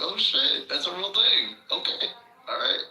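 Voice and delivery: high, loud startled oh shit; surprised yelp that turns into a sheepish okay; surprised realization. Retold or imitated. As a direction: caught off guard by the little hat. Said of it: "I was like".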